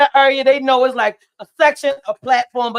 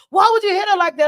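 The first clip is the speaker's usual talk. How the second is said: mild shout; 6 semitones higher than usual. Retold or imitated. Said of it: "Her mother's like".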